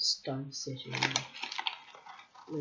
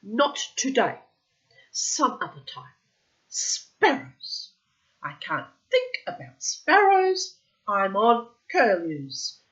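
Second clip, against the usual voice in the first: irritated; 3 semitones higher, 6 dB louder than usual. A narrator's reading. God irritated at Sparrow.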